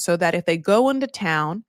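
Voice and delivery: ordinary delivery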